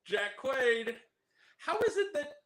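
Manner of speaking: ordinary talk, nothing marked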